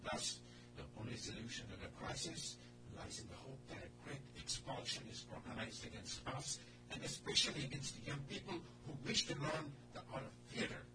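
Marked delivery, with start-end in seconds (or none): none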